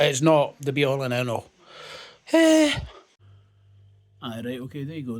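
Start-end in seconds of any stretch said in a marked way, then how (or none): 2.3-2.8 a high, loud startled yelp; the burn hits, yelp it out; pained yelp from chilli heat. Real, not acted. Tasting a hot sauce on camera, he yelps at the burn.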